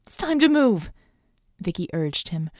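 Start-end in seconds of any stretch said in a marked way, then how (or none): 0.0-0.9 high, strained urging; raised, worn voice urging action; hoarse urging. A narrator's reading. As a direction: push it out hoarsely. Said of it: "her voice sounding hoarse".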